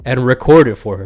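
loud, fed-up outburst; angry rant. Real, not acted. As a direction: let the frustration boil over. Ranting about the thrown-out muffin.

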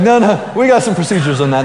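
quickly head them off, half laughing; hasty amused refusal. Retold or imitated. Leader stopping kids dismantling the salad bar, hasty amused refusal.

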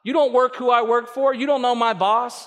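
a hint of a whining employee; whiny listener. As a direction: play the griping worker. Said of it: "You go".

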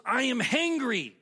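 loud, high, slow mock outburst; mad cousin. Hungry cousin snapping at slow server, mock angry. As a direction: act out an irritated, hungry cousin. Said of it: "She's getting mad at me".

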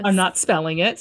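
ordinary talk

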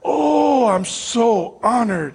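slow, drawn-out mock reverence; hammed-up awe, starting high; imitated awe. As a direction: stretch each word as an awestruck host. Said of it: "His entire countenance changed".

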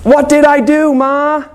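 put-on high, wailing little boy voice; kid yelling. Schwartz crying out to his mom over the smacks, high kid wail. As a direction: wail it like a kid getting a whooping. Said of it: "Schwartz yell".

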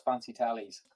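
"fancy tallies" said softly in a Welsh lilt; Welsh term. Shown as a demonstration. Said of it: "or as we say in Wales".

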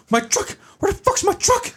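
very high-pitched comic outcry; frantic film quote. Retold or imitated. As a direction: mimic someone panicking over a loss. Quoting a frantic film line.

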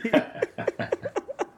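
high breathless burst of laughter; can't hold the laugh in. Real, not acted. Laughing hard at raunchy joke.

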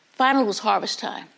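ordinary delivery

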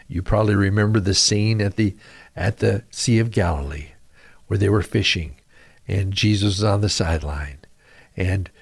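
ordinary delivery

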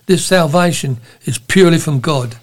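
usual speaking voice

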